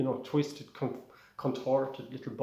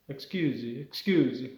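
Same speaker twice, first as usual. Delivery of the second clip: sing-song Italian "excuse me"; Italian accent. Acted. Student at the back seeks attention, Italian accent. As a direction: put on a cartoon Italian accent. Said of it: "that's the way you do an Italian accent".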